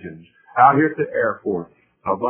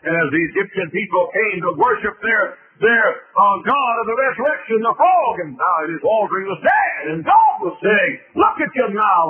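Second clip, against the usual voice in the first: high-pitched, mounting sermon shout; full-force preaching; 10 semitones higher, 5 dB louder than usual. Acted. Preacher sets I am against I was gods, full-force preaching. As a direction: build it up like a revival preacher.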